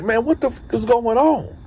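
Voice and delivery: be caught off guard by the handcuffs; alarmed protest. Retold or imitated. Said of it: "I'm like".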